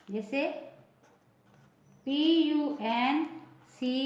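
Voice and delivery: normal talking voice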